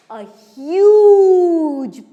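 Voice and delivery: HUGE drawn out long and loud; dramatic emphasis. A narrator's reading. Storyteller describing the banyan tree, HUGE stretched loudly. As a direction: stretch HUGE to show the size.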